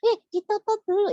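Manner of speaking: put-on squeaky child puppet voice, confused; high puppet voice. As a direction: play the little puppet mishearing. Puppet voice of Mulia.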